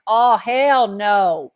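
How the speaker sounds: loud, slow, drawn-out refusal; big mock-defiant shout; defiant hell no. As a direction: belt it out like slamming a door on the devil. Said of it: "we're like".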